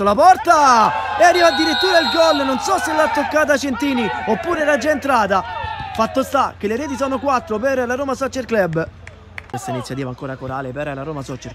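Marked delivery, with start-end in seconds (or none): 0.0-8.9 higher and louder goal shout; goal call. Real, not acted. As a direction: cry out the goal from a corner. Corner goes straight in.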